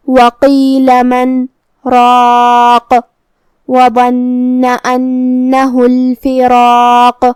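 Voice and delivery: slow melodic tajweed chant; Quran recitation. Shown as a demonstration. Teacher recites the passage for students, slow chant. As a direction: recite steadily, letters drawn out. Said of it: "verse recited in full".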